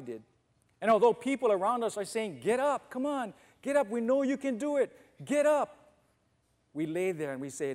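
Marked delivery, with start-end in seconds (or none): 2.4-5.9 higher, urgent shouts of encouragement; rallying cheer. Retold or imitated. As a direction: cheer him back on his feet. Crowd cheering a fallen fighter on.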